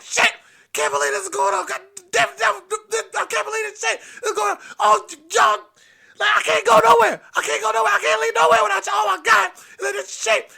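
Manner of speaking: high-pitched, loud frantic rant; cussing grandpa. Retold or imitated. Grandpa battling the overflowing toilet, high cussing rant. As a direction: curse in a high, shouted panic. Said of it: "He plunging and cussing".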